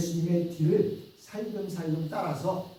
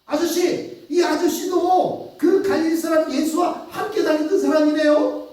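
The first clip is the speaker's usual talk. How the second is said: very high, loud and surprised; second servant girl; 10 semitones higher, 6 dB louder than usual. Acted. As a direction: point at the stranger in shrill surprise. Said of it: "또 다른 여종이 와서 얘기를 하는 거예요".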